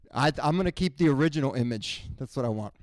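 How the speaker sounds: ordinary delivery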